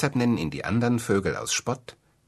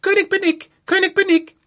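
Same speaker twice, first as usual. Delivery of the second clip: much higher, louder tiny bird voice; fine high voice; 9 dB louder than usual. A narrator's reading. The wren calls up from the mousehole, tiny and high. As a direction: squeak it out of the mousehole, thin and high. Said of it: "mit seiner feinen Stimme".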